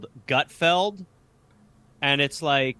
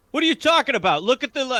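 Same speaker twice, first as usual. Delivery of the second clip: high, loud mock outrage; hammed-up indignant heckler; 10 semitones higher, 5 dB louder, much faster than usual. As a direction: play the offended crowd, shrill and loud. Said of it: "people will be like".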